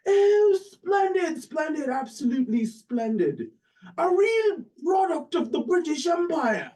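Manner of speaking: very high, hammed-up Oxford English delight; British Oxford accent. British professor delighted at the student's parentage, exaggerated Oxford accent. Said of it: "this is my best British Oxford accent".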